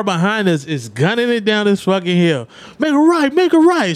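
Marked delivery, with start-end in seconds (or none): 2.8-3.9 high, louder shouted directions; mock-panicked teenager shouting; panicked yell. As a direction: yell at the driver to turn now. Scared teens yelling directions.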